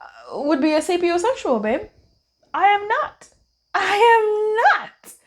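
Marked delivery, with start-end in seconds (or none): 2.5-5.1 lightly voiced spouse protesting, higher; a playful high-pitched "I am not", stretched the second time; mock protest.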